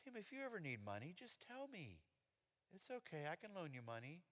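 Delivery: soft, gentle fatherly voice; kind dad. Retold or imitated. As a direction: forgive him tenderly. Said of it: "with the kindest look in the world and said".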